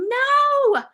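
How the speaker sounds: high, loud retold yell of protest; angry yelling. Woman refusing God's call, re-enacting her high, loud yelling. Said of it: "I remember yelling".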